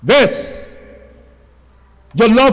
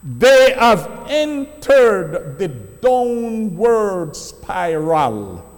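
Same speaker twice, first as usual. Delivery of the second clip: preaching at full force; 3 semitones higher, slower than usual. Acted. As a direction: thunder the warning to the congregation.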